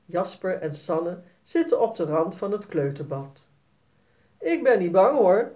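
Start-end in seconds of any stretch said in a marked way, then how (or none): 4.4-5.5 put-on louder, bold little-boy boast; playing it cool and fearless; tough. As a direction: act brave in front of the girl. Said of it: "zegt Jasper stoer".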